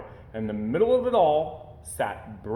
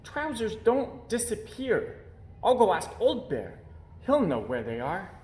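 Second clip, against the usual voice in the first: put-on high, childlike bear voice, puzzled; a small bear who can't find his trousers; puzzled little bear; 8 semitones higher than usual. A narrator's reading.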